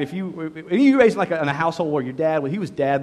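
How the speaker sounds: ordinary talk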